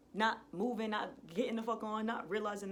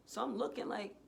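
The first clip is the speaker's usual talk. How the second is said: ordinary delivery, nothing marked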